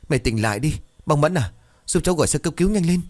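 daughter-in-law crying out for an ambulance, panicked; panicked plea. Acted. Mother-in-law fainted, calling for help.

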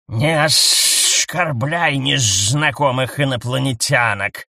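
slow, stern hushed rebuke; the snake husband shushes his wife sternly; stern hushing husband. A narrator's reading. Snake husband sternly shushes his wife. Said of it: "строго шикнул муж".

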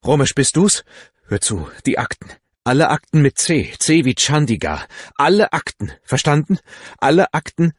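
loud, high, panicky phone orders; trembling voice. A narrator's reading. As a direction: bark urgent instructions in alarm. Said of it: "Bandaris Stimme zitterte".

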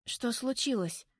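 lightly voiced worried girl; frightened small voice. A narrator's reading. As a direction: ask with a touch of fright. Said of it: "Тихий голосок звучит испуганно".

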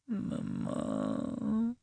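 put-on soft, high child's murmur; sleepy mutter. A narrator's reading. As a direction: mutter drowsily, half asleep. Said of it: "he muttered".

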